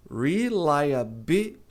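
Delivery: reliability começando com R inglês, devagar; reliability with English R. Shown as a demonstration. (reliability com R inglês, pausado)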